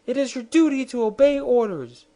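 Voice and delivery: high, loud insistent "You must!"; queen crying out at her private; cry of command. Acted. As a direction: demand obedience at once.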